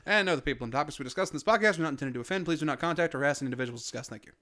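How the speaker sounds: brisk announcer read; podcast intro read. Acted. Opening the podcast for listeners, announcer read. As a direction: present the show with polish.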